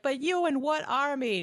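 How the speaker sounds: high mocking sneer; pirate taunt. Pirates mocking Aragorn's tiny group, high sneer. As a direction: jeer it like a cocky pirate.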